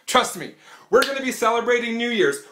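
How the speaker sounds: ordinary delivery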